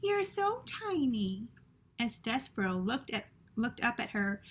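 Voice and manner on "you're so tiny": sweet princess. Acted. Princess speaking to the tiny mouse, high and cooing. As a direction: coo it like a gentle princess. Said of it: "said the princess".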